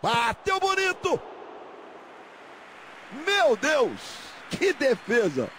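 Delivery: high, amazed call on the save; excited commentary. Real, not acted. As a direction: marvel at the save.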